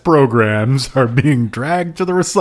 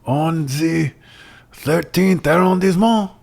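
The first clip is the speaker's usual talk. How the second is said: put-on French accent; 3 semitones higher than usual. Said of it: "Don't do a horribly offensive accent".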